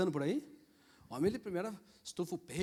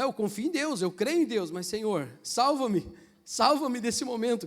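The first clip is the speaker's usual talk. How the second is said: louder, higher cry to God for rescue; desperate plea; 4 semitones higher, 7 dB louder than usual. Retold or imitated. Preacher reliving storm prayer, desperate louder plea. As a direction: beg the Lord to save you, urgently.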